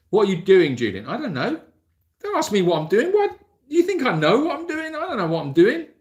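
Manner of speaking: high, loud comic exasperation at himself; teasing himself for rambling, high and loud; mock self-scolding. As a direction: tell yourself off, hammed up.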